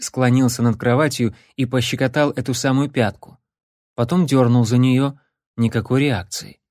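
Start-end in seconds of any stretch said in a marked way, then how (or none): none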